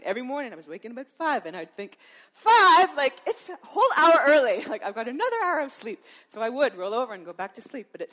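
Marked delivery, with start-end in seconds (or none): 2.3-6.0 loud, high, incredulous wake-up grumble with a laugh; groggy disbelief. Retold or imitated. Woken at five, grumbling to herself in loud disbelief. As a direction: protest the early hour, half laughing.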